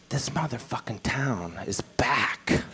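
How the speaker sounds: slow, laughing whisper; whispered punchline. Real, not acted. Comedian delivers triumphant closer to crowd, slow laughing whisper. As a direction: breathe it out in awe.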